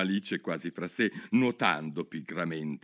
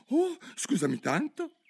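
much higher "Oh, scusami tanto" in one breath; quick cry. Acted. Alice apologizes to the startled mouse, high and breathless. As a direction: blurt the apology. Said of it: "gridò Alice tutto d'un fiato".

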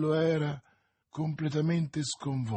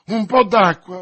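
loud, raised, insistent cry; urgent shout; 5 semitones higher, 12 dB louder than usual. A narrator's reading. D'Artagnan calling for water for her, urgent shout. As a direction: shout it out desperately. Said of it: "gridava D'Artagnan".

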